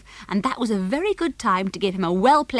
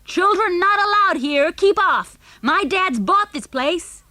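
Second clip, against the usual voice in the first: put-on high, bossy child's shout; loud yell; 9 semitones higher, 5 dB louder, slower than usual. A narrator's reading. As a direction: yell a warning across the field. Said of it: "yelled loudly".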